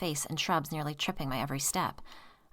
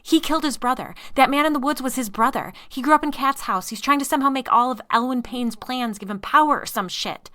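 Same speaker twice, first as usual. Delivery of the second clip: frantic shouting; 8 semitones higher, 10 dB louder than usual. Acted. Woman frantically accusing husband.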